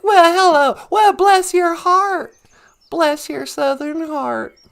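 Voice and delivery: slow mock Southern drawl; Southern accent. Answering Gwen on her girls' trip, mock Southern accent. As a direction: play up a gracious Southern greeting. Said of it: "they have an accent".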